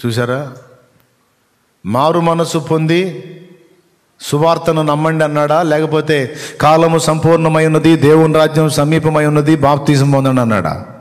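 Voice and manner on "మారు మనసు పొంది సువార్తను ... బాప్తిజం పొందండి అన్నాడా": high, loud rhetorical challenge; raised, insistent preacher's voice; full-force preaching. Real, not acted. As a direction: fire the quotation at the congregation as a question.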